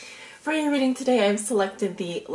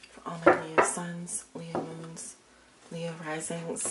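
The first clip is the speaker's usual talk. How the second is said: murmured invocation. Acted. Repeats invocation while shuffling.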